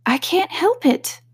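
put-on high, slow, timid girl; meek Alice. A narrator's reading. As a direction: say it meekly, like a shy child. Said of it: "said Alice very meekly".

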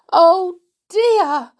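high, loud, drawn-out dismay; distressed wail. A narrator's reading. Aunt covering her eyes after the crash, distressed. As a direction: cry out in helpless dismay. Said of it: "said poor Aunt Fanny".

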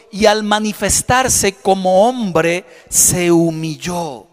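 very slow, weighty reading of the verse; slow scripture reading. Acted. Pastor reading Philippians to the church, very slowly. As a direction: let each word land.

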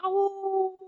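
high, haunting howl; one eerie wolf howl. A narrator's reading. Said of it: "he howled out a pitch that felt quite haunting".

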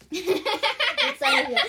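high, loud, slow words through laughter; laughing. Real, not acted. Blackout, laughing at herself.

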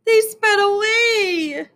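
high, drawn-out wail; sobbing, acted. A narrator's reading. Dana answering Myron about her hurt dog, acted sobbing. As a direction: wail it slowly like a distraught child. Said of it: "Dana sobbed".

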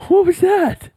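high startled question; startled, shrieking up high; shocked. Real, not acted. Drink spilled on gear.

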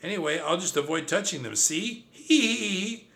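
high, cocky giggle; laughing. Acted. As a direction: laugh off the danger. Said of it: "My cousin laughs".

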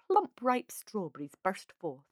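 ordinary delivery

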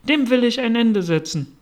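high, loud determined outburst; firm resolve. Acted. Alice resolves to stop it.

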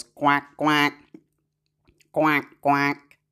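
light playful duck quack. Acted. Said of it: "mouth of a duck".

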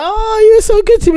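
high, loud, hammed-up crying queen; mock weepy Dany. Friend mocking Dany crying over Jorah, high hammed-up wail. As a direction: squeal it like a sobbing queen mocking herself. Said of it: "And she's like".

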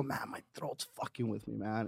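very quiet, low remorseful mumble; subdued and low, voice sunk with regret. Retold or imitated. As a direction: imitate a sheepish morning-after complaint. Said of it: "To hear the remorse in your fucking voice".

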